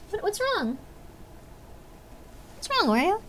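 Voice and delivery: high concerned voice to the cat; pet talk. Real, not acted. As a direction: ask the cat gently.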